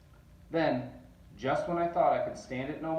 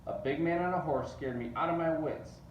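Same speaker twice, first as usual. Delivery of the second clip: ordinary delivery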